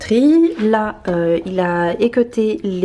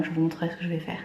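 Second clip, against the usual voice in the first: ordinary talk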